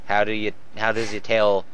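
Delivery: normal talking voice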